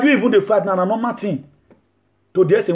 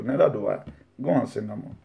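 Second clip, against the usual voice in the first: soft repeat; 9 semitones lower, 8 dB quieter than usual. Acted. Jesus gently dismissing the woman, hushed. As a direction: lower and much softer, gently releasing her.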